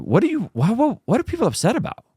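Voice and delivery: rising, high disbelief; confused why people are upset; baffled question. Real, not acted. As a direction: ask it truly puzzled. Confused by backlash.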